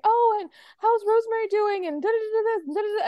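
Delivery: lightly voiced prying neighbour; chatty Violet. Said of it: "Violet's like".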